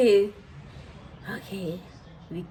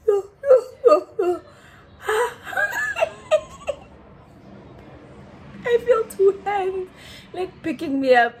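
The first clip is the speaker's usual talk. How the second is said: wheezing with laughter mid-sentence; laughing; 8 semitones higher than usual. Real, not acted. Laughing hysterically while telling how she was picked up.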